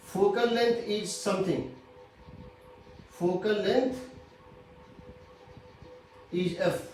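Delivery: slow, drawn-out teacher dictation. Real, not acted. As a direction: dictate very slowly, word by word. Teacher dictating a rule slowly.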